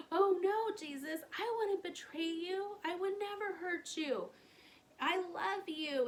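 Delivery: high, earnest protest, slowing at the end; pleading disciples. Retold or imitated. Disciples to Jesus at the Last Supper, high pleading protest. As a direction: beg him to believe you. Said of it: "And his disciples were like".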